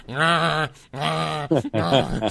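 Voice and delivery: repeated drawn-out mock cries; imitated "rah" cries. Woman in a wheelchair in the hallway, imitated repeated cries. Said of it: "in the hallway going".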